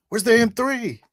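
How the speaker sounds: very high, loud in-character question. Acted. Player calls to runner for the M three, high character voice. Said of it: "and I say".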